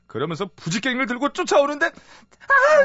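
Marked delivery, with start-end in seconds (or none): 1.9-2.9 high, loud comic cry; mock wail. Henpecked husband recalling wife chasing him with poker, mock wail.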